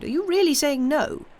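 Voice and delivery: high, loud, disbelieving question; incredulous exclamation. A narrator's reading. Notary challenging the invalid's refusal, loudly incredulous. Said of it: "the notary exclaimed".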